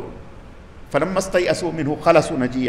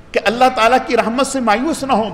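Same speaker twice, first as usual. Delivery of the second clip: ordinary talk, nothing marked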